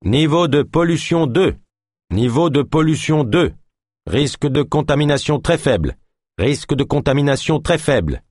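high-pitched public alert voice, evenly repeated; high announcer voice repeating a pollution bulletin; automated announcement. Acted.

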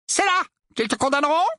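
high-pitched, hopeful question; eager uncle. A narrator's reading. Uncle hopes nephew is condemned. (oncle avide, voix aiguë)

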